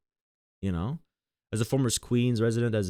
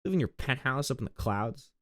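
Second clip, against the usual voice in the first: mock angry retort; 6 semitones higher than usual. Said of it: "It's like".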